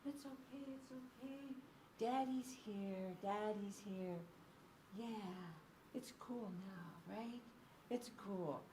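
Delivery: put-on soft, hushed comforting of a crying baby; calm the little one down gently; quiet, slow daddy's here murmur; soothing dad. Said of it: "He's like".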